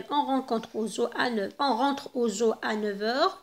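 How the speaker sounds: very slow, clear French example; slow read-aloud. Shown as a demonstration. Teacher reading the example sentence to pupils, slowly. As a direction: read it word by word. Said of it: "teacher reads example sentences slowly".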